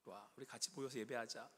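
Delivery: put-on soft whisper calling the children in; God's hushed gathering call; quiet, gentle call. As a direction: draw them near tenderly in a hush. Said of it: "조용히".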